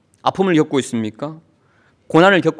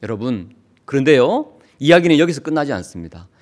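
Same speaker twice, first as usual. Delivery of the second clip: ordinary talk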